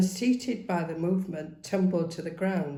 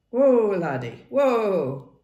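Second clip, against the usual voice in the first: calling whoa; 3 semitones higher, 9 dB louder, much slower than usual. Acted. Lachlan reining in the pony.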